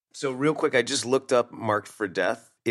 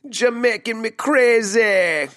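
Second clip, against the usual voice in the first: high, loud, drawn-out Jamaican accent; terrible Jamaican accent; 12 semitones higher, 6 dB louder, slower than usual. Shown as a demonstration. Host showing how the ad needs saying, terrible Jamaican accent. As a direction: belt it out in a bad Jamaican accent. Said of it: "say it in that terrible Jamaican accent".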